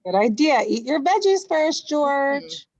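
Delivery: bright fatherly urging; upbeat man's voice. Acted. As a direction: chirp it like a cheery dad. Man voiced in read story.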